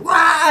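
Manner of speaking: high, loud squealing yell; a shrill little battle scream; gnome war cry. Retold or imitated.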